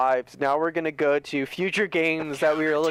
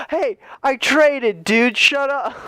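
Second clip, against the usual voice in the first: high, loud defensive comeback; heated mock shouting, pitched up; imitated angry retort; 9 semitones higher, 6 dB louder, slower than usual. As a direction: yell back, imitating your offended teammate. Teammate yells back in retold quarrel.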